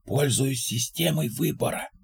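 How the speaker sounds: very soft secretive voice. A narrator's reading. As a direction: share a secret hushed. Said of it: "Биг понизил голос".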